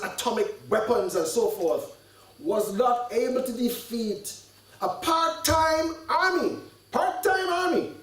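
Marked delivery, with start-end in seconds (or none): 4.8-7.9 scornful emphasis. Real, not acted. Scoffs at Israel's defeat.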